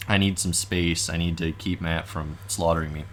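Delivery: ordinary delivery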